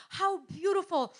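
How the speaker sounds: ordinary delivery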